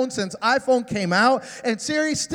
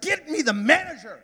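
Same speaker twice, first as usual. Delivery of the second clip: higher, strained mock outburst; frustrated demand; 5 semitones higher than usual. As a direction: act out an exasperated customer's demand. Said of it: "I'm getting frustrated".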